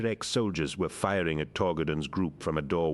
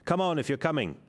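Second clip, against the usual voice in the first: high, raised call; yelled; 11 semitones higher, faster than usual. A narrator's reading. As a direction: hurry them along. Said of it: "Torgadon yelled".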